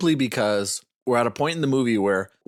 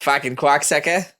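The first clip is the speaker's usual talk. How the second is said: mock Boston accent, a touch louder; famous Boston quote; 4 semitones higher, 4 dB louder than usual. Husband does his famous Boston accent quote on the podcast. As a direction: spit it out like a Boston local. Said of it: "my famous Boston accent quote".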